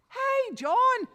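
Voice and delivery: loud, high call across the farm; wife shouting for her husband about the missing cow. Acted.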